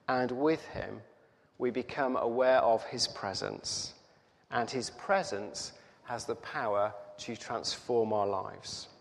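ordinary talk

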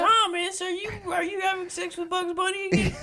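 put-on squeaky falsetto child voice; mock grandkid. Grandkid pestering Grandpa with questions, squeaky falsetto. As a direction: put on a very high little-kid voice. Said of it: "Your grandkids say".

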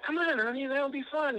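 put-on high-pitched sweet coaxing girlfriend voice; coaxing date. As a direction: coax him inside, way up high and sugary. Dates coaxing men into barbershop.

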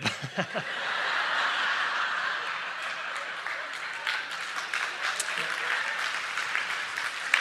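soft real laugh at own joke; breathy laugh. Real, not acted. Preacher laughing at his own joke, soft chuckle. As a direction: chuckle at the punchline.

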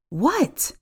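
sharp rise in pitch, irritated; angry hiss. A narrator's reading. Narrator answers her phone again after sister's call, angry hiss. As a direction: snap it irritably, pitched high. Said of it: "I'd hissed into the phone".

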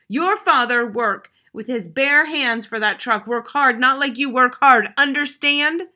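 loud, slow angry lecture; stern scolding. Acted. Mother scolds her son about the truck, loud angry lecture. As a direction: scold him hard.

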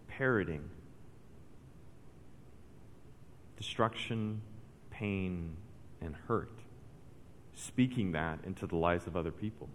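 hushed sermon. Acted. Preacher warning church members, quiet and grave. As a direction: drop it down and let the warning sink in.

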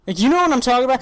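usual speaking voice

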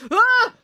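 high, loud startled yelp; startled cry. A narrator's reading. The paperman startled by the leaping dog, yelp. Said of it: "cried the delivery man".

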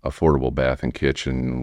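normal talking voice

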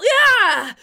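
high, loud guttural yell; explosive angry cry; guttural outburst. A narrator's reading. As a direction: let out an uncontrollable roar. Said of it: "A guttural".

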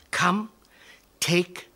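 ordinary delivery

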